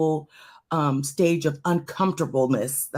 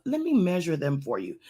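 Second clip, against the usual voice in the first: usual speaking voice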